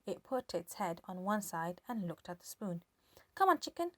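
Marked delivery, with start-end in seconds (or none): 3.3-3.9 coaxing hen. A narrator's reading. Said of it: "George said".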